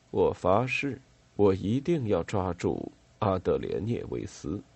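plain reading voice

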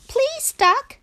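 very high, scared little Frog voice; frightened pleading. Acted.

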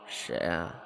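a soft, half-asleep mumble; drowsy question. A narrator's reading. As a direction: ask as if just woken. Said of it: "迷迷蒙蒙地问". (迷迷糊糊地问)

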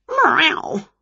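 high, sudden meow; startled cat. A narrator's reading. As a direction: yowl like a cat jolted awake.

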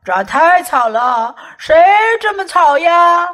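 loud booming father; roaring. Acted. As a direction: roar it like an angry bear. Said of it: "熊爸爸咆哮起来".

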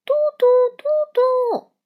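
very high, loud delighted calling; a little girl squealing her toy's name; joyful shout. A narrator's reading. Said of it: "佩佩高兴地又跳又喊". (高兴地又跳又喊)

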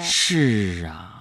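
sloth. Pretend. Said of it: "用树懒的方式来说话".

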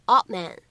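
"odd man" with the d held unreleased; incomplete plosion. Shown as a demonstration. Teacher showing learners "odd man" with an unreleased d. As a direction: stop the d of "odd", straight into "man". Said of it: "可以有一个不完全爆破的处理".

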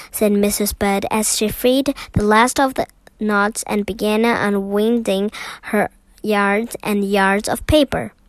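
ordinary delivery, nothing marked

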